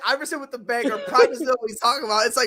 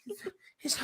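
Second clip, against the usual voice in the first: mock crying; 9 semitones higher, 11 dB quieter than usual. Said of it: "He was crying about it".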